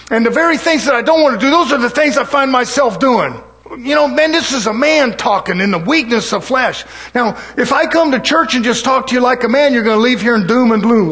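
loud, high, driving sermon delivery; forceful preaching. Acted. Preacher to brethren on the weakness of flesh, forceful preaching.

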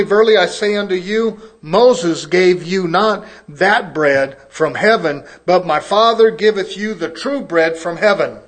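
booming pulpit scripture reading. Acted. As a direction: proclaim the verses like a preacher. Reading scripture aloud in KJV.

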